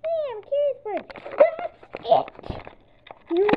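very high, loud screech; shrieking high and loud; furious yell. Acted. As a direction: pigeon at the end of its rope. Pigeon exploding.

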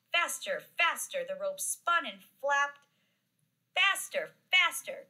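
excited, escalating narration; building excitement. A narrator's reading. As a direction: whip up the storybook frenzy. Rope speeds up in the story.

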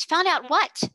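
startled demand. A narrator's reading. Headmistress demanding an answer, startled rising question. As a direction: press her, shaken. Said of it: "she exclaimed".

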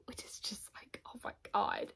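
hushed, breathless whisper of awe; a choked-up whisper about the book; emotional whisper. Real, not acted. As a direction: whisper it, overcome by the story.

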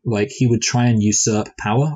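usual speaking voice

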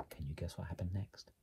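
soft unvoiced whisper, building suspense; suspenseful whisper. A narrator's reading. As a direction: whisper it to tease the big moment. Teasing question to young listener.

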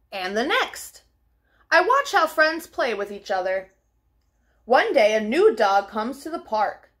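ordinary delivery, nothing marked

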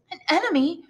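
high, shocked indignant question; indignant cry. A narrator's reading. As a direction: sound offended and amazed. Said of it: "cried Mercedes, with an indignant look".

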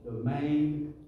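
very slow, weighty delivery; drawn-out emphasis. Real, not acted. Preacher driving home the power of prayer, very slow. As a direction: stretch each word for weight.

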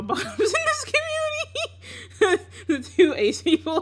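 laughing so hard it cracks; wheezing with laughter; laugh-crying. Real, not acted.

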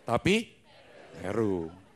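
ordinary talk, nothing marked

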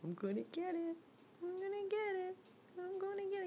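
high teasing singsong. Real, not acted. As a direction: tease the pet, rising playfully. Playful teasing of the pet.

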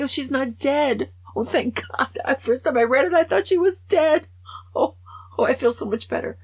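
high, loud rush of relief; surprised relief. Real, not acted. Reader discovering the friend isn't dead, surprised relief. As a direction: burst out as the good news sinks in.

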